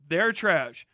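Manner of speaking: ordinary talk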